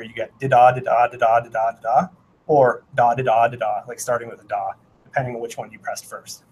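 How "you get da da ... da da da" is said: imitated morse. Shown as a demonstration. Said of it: "with an iambic here".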